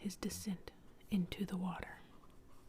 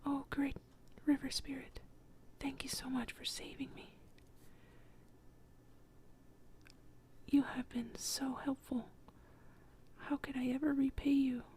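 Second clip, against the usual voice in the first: whispered thanks, pitched higher and eager; a hushed but bubbling gratitude; overjoyed boy; 6 semitones higher than usual. Acted. Boy thanking the river spirit, mildly excited in a whisper. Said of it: "He's overjoyed".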